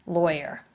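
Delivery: "lawyer" said LOY-er, oi vowel; lawyer with an oi sound. Shown as a demonstration. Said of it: "Here's the way I say it".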